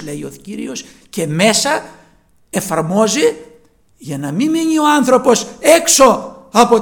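loud, high pleading sermon voice; preaching at full force. Acted. Preacher urging listeners to pray to see the Lord, fervent full-force preaching. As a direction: urge them to ask God, rising to a plea.

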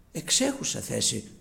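usual speaking voice

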